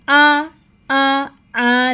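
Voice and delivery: "आ" held long on a level note; fatha vowel demo. Shown as a demonstration. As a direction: model each "आ" as a separate sustained vowel. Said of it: "फतहा की sound बताई थी". ("आ" को लंबा खींचकर बोलें)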